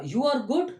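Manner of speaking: You are good with good rising high; "You are good" asked with a rising tune on good; questioning rise. Shown as a demonstration. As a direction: lift GOOD up like a doubtful question. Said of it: "See the modulation, tonal variation".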